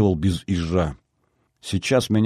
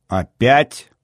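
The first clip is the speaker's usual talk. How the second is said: higher, sharp questioning; mildly angry, raised pitch; cross; 4 semitones higher, 4 dB louder than usual. A narrator's reading. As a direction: demand it crossly. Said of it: "сердито нахмурилась девушка".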